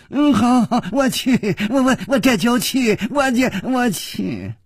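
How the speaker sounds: high, stammering, cowed agreement; hasty stammer. A narrator's reading. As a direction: babble yes to escape. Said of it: "连声说". (连声结巴地答应)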